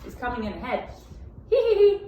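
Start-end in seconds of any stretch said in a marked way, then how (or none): 1.5-2.1 high, loud cheeky hee-hee; gleeful giggle. A narrator's reading. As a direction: giggle like you've escaped. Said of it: "laughed the gingerbread man".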